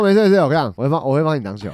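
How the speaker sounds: hammed-up drunken reassurance; mock drunk shout at a friend; loud drunk insistence. Replaying his own drunk call.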